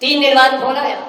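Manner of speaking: ordinary talk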